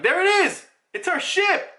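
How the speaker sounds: high, excited character voice; excited sighting. Acted. Voicing crew spotting their ship.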